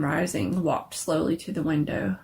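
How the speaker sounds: ordinary delivery, nothing marked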